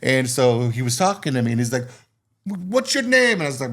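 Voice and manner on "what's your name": high-pitched mimicked question; imitating resort man. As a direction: ask in the man's high voice. Acting out resort man asking.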